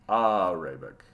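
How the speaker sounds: "Arabic" with a stretched AH, low: AAH-ray-bik; first vowel AH. Shown as a demonstration. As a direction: draw out the opening AH of "Arabic". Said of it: "not Arabic".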